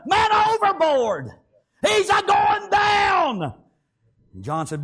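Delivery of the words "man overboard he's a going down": high, drawn-out alarm call; panicked crew. Acted. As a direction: holler it long across the boat.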